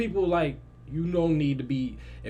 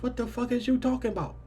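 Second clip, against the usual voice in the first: high-pitched mock disbelief; baffled; 8 semitones higher than usual. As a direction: question her, pitch shooting up. Acts out baffled reply to cousin.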